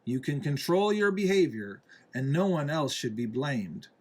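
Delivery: usual speaking voice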